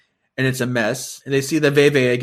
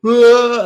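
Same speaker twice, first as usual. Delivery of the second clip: high, loud wail like a screeching cat; a sudden loud wailing cry; howling wail; 11 semitones higher, 11 dB louder than usual. Retold or imitated. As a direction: let out an eerie howl. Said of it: "you hear her just go".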